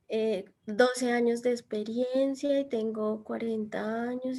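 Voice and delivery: slow, soft and unvoiced, as if half asleep; lifeless mumble. Teacher imitating a sleepy job candidate, lifeless whisper. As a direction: whisper the answer with no energy at all. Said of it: "esta persona se murió".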